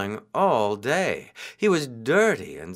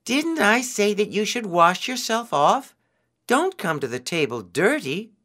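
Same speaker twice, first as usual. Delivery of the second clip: scolding; 8 semitones higher than usual. A narrator's reading. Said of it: "Anansi yelled".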